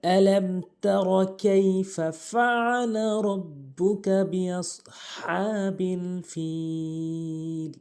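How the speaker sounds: slow chanted Quran recitation; recite along. Shown as a demonstration. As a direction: lead the class in melodic recitation. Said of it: "আমার সাথে বলতে থাকুন".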